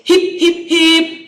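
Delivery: high, loud cheerleader call of hip hip hip; birthday cheer call. Retold or imitated. Teacher imitates the birthday crowd starting a loud cheer. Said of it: "you hear people say".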